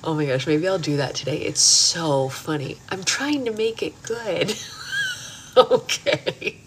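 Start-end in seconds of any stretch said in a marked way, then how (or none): 2.9-4.5 high, slow small-child impression; drawn-out, pitched-up child voice; niece's little voice. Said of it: "her little voice is too cute". 4.6-6.6 high, helpless giggle into the word; real amused laugh. Real, not acted. Cracking up at niece's line.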